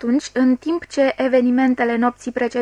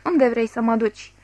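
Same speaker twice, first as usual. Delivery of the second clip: ordinary delivery, nothing marked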